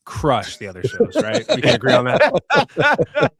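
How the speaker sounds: high, slow, hammed-up boast; over-the-top macho bluster; mock macho boast. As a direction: ham up the manly brag, slow. Joking about testosterone.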